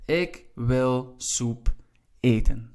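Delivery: "Ik wil soep... eten" word by word, very slow; spaced out, with a pause before a firmer "eten"; slow word-order demo. Shown as a demonstration. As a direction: teach "Ik wil soep eten" slowly, setting "eten" apart at the end. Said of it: "So your main verb, your second verb".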